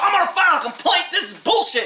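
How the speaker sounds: a hammed-up indignant threat; storming off. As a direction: imitate the lawyer stomping away in a huff. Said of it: "So he storms off".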